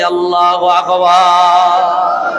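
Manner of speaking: Allahu Akbar stretched long and called out; slow drawn-out call. Real, not acted. Preacher leading the congregation's cry, drawn out. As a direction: lead the crowd's cry slowly. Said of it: "একটু আওয়াজ দিয়া বলি".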